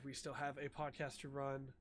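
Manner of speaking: ordinary delivery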